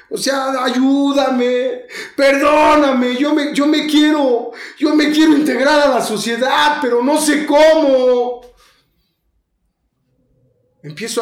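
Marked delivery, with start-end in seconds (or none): 0.1-8.4 grita la súplica, más agudo y fuerte; súplica a gritos. Retold or imitated. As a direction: clama a Dios desesperado, lento al final. Súplica a Dios, llorando. (súplica gritada y desesperada)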